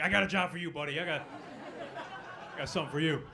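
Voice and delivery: loud, chummy recruiter pitch; slick recruiter. Retold or imitated. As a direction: reel the kid in like a salesman. Recruiter baits a kid.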